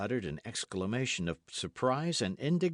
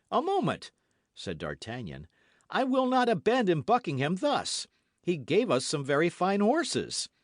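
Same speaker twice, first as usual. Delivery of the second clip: urgent protest; 7 semitones higher, 4 dB louder than usual. A narrator's reading. Protests, defending Buckingham.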